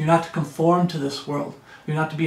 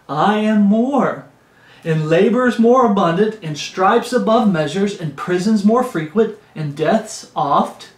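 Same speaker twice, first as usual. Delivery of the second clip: carnival preaching; 3 semitones higher, 4 dB louder than usual. Acted. Preacher reading Paul's foolish boast, carnival preaching. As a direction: roll out each item like a showman preacher. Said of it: "I'm getting that carnival preaching going".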